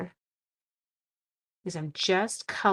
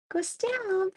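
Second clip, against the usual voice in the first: "Go stand." pitched much higher, stress on stand, falling; mock son; 14 semitones higher than usual. Retelling the son's order, in a mock high voice. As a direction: hams up "Go stand." high and sing-song. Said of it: "That was his response to me, literally".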